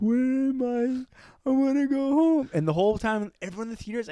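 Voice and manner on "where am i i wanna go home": high, very slow mock wail; imitating a lost, pleading character; imitated lost girl. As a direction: drag each word out in a put-on high voice. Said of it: "And she's like".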